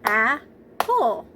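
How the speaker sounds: "Apple" in two separate beats, one per clap; clapped-out syllables. Shown as a demonstration. Teacher clapping a word out with preschoolers, syllable by syllable. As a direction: break "Apple" in two with a clear pause. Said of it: "Let's clap".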